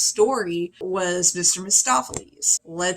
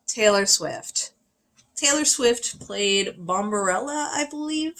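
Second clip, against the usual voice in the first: ordinary talk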